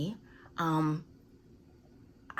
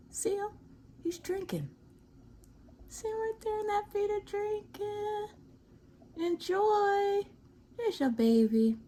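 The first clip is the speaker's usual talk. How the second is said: much higher sing-song coo; cooing at the bird. Real, not acted. Cooing at the hummingbird.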